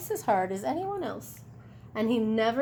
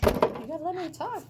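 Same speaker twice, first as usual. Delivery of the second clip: mild exasperation; 7 semitones higher, much faster than usual. Real, not acted. Mother hushing interrupting child.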